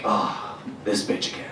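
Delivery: weary whispered gripe; annoyed mutter. Retold or imitated. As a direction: sigh it under your breath. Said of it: "you're like".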